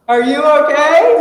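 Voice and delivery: loud, high shout across water; calling out. Retold or imitated. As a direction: call out to someone in the river. Guide calling to swimmer in river.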